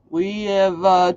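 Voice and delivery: ordinary delivery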